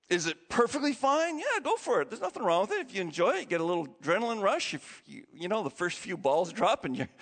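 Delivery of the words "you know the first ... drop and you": ordinary talk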